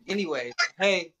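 ordinary talk

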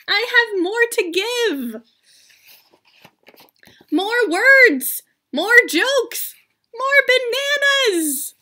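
lively, high character voices for a picture book; two story characters. Acted. Teacher voicing Gerald and Piggie for students. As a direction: act out Gerald and Piggie for the class. Reads Elephant and Piggie aloud.

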